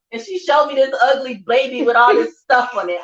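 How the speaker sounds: ordinary talk, nothing marked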